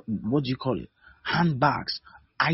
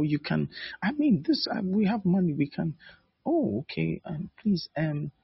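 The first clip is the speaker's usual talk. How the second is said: put-on higher, hesitant girlish voice, coyly beating around the bush; coy young woman; 6 semitones higher than usual. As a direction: play the shy girl hinting at what she wants, pitched up.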